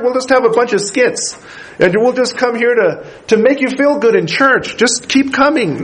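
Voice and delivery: high, begging plea, hammed-up; mock pleading. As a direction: beg them to stay, mockingly over the top. Mock pleading to keep churchgoers.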